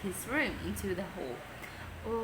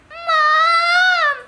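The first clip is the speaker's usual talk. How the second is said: much higher, much louder cry; shouted; 16 dB louder than usual. A narrator's reading. Peter shouting for Mom to rescue him. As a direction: yell for help at full volume. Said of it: "shouted Peter".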